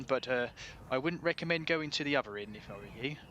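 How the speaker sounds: kindly West Country shopkeeper; apologetic local shopkeeper with a West Country lilt; West Country accent. Acted. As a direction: put on a friendly West Country voice. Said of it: "best West Country accent this is".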